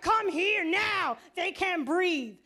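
high, slow, drawn-out holler; urgent yell. Retold or imitated. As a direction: shout each word out in alarm. Daughter yelling that they can't breathe.

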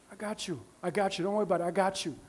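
soft, low and calm; almost whispered comfort, unhurried; hushed reassurance. Acted. God comforting someone waiting for a spouse, hushed and calm.

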